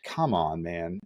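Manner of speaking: low, trailing mock disappointment; let-down instructor. Calm instructor to trainee who slipped up, low disappointed reproach. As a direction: drop the pitch and soften off at the end. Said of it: "he would just be looking at me".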